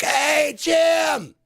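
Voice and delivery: very high, loud delighted shout; spotting a best friend, joyful shout; greeting a best friend. Shown as a demonstration. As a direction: yell it across the street to your buddy. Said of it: "like you do when you see your best friend".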